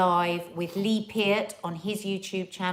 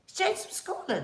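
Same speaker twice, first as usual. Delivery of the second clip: put-on Scottish accent; 11 semitones higher, 6 dB quieter than usual. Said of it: "in a Scottish accent".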